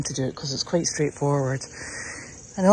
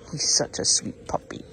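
a soft whisper about the puppy; 5 dB quieter than usual. Real, not acted. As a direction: whisper it fondly.

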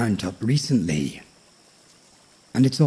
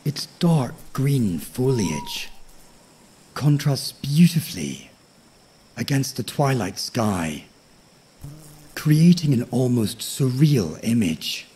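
nature documentary. A narrator's reading. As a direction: lull the listener toward sleep. Sleep story narration of tree.

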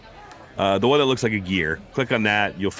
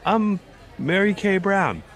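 put-on high boy's voice, correcting his name; squeaky schoolboy protest; prepubescent boy voice; 10 semitones higher, slower than usual. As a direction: keep the pitched-up teen boy going. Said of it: "Your voice has gotten just more like".

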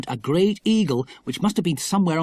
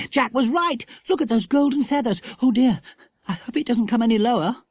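put-on high little-girl wonder turning to worry; marvel, then fret nervously; high-pitched, excitable child; excited Lucy Ann, anxious; 8 semitones higher than usual. Story dialogue as Lucy Ann.

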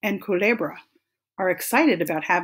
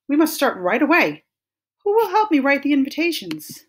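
high, loud, urgent call to friends; rally everyone to get started; brisk, high-pitched eager appeal; eager organiser; 7 semitones higher, 5 dB louder, faster than usual. A narrator's reading. Iguana eagerly organises the party.

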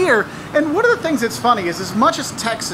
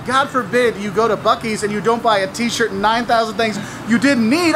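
ordinary delivery

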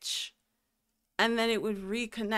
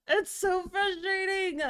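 higher, exasperated whine; pitched-up exasperated whine; high whiny complaint; 9 semitones higher, 4 dB louder than usual. Real, not acted. Repeats the complaint, exaggerated.

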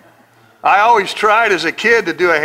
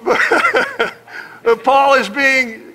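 ordinary talk, nothing marked

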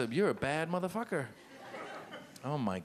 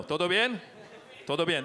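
Spanish accent. Shown as a demonstration. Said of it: "I do it with a good accent".

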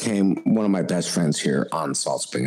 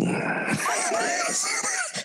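burst of high laughter; 5 dB quieter than usual. Real, not acted. Reaction to the confession.

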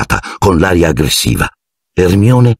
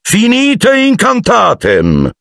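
high, slow firm incantation; shouted spell; much slower than usual. A narrator's reading. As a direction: end the spell with authority. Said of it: "gridò". (incantesimo gridato con autorità)

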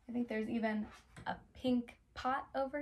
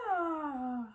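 pretend yawn; 2 semitones higher than usual. Shown as a demonstration. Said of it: "You can yawn".

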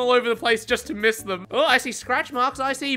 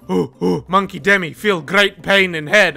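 caveman voice; 7 semitones lower than usual. Pretend. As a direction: talk like a dim caveman in pain. Mock caveman talk about headache.

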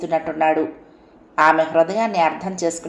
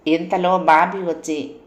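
ordinary delivery

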